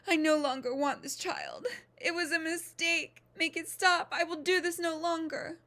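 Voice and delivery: high, loud, desperate crying out; crying out in labour. Acted. Wife in labour begs Beetle to make it stop, crying out high and loud. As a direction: beg through the pain, voice cracking high. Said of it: "She grabbed Beetle's arm and cried".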